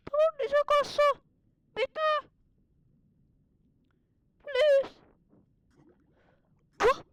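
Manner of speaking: high, excited cartoon voice all through; squeaky put-on voice. Kid gamer in a high silly voice reacting to the fish. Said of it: "miksi mä puhun tuolla äänellä".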